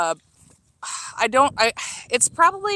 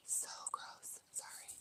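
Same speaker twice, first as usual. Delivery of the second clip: whispered aside; 25 dB quieter than usual. Real, not acted. Aside after dog.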